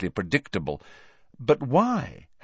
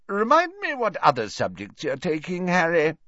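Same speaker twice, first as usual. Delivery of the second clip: higher, jovial professor's question; Slughorn, genial; 9 semitones higher than usual. Acted. Merry professor asks his pupil about subjects, high and genial. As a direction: ask with tipsy, high-voiced interest.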